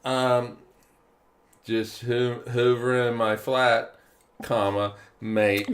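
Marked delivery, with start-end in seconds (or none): 2.5-5.7 "flat", spoken "comma", then "mate", each set apart; pause before MATE. Shown as a demonstration. Co-host correcting the flatmate mix-up, pointed pause before "mate". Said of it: "Commas are extremely important".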